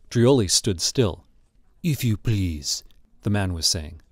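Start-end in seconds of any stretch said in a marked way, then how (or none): 1.8-2.8 slow, measured dismissal in character; gallery owner. A narrator's reading. Gallery owner asking the intruder to leave, slowly and coolly. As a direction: draw out the polite order.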